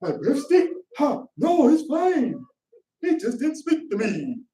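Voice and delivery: mock a touchy churchgoer fretting; mocking a whiner. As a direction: parody of someone offended at a snub, whining high. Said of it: "When you act".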